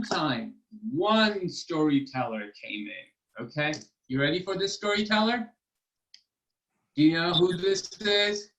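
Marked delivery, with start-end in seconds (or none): none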